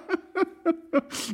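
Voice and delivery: fox's high giggle; a quick crafty laugh; sly high laugh. Acted. Fox laughs.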